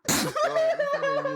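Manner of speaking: very high, fast, giggling; laughing. Real, not acted. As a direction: laugh through the restart. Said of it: "I'm gonna go back from the beginning".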